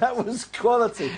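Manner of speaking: real amused laugh in the words. Real, not acted. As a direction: say it through a chuckle. Amused by the corner clip.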